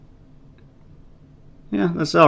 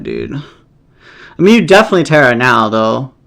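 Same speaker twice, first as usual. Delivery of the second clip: ordinary talk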